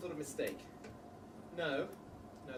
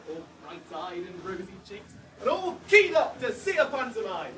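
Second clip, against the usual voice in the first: normal talking voice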